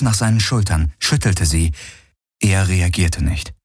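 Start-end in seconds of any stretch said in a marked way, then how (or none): none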